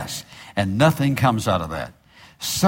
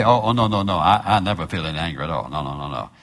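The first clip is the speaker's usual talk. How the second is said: mock denial; 6 semitones lower, faster than usual. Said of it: "Well, you're just lying".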